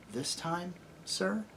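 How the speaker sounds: very soft, rising question; timid girl. A narrator's reading. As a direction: ask meekly, barely aloud. Aravis nervously questions the lion.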